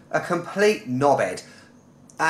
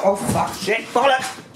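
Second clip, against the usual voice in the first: high-pitched mock drunken swearing; wasted girl; 6 semitones higher than usual. Acting out the wasted girl backpacker, high-pitched swearing. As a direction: play a wasted girl cursing. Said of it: "the wasted girl".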